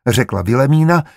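ordinary delivery, nothing marked

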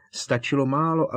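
usual speaking voice

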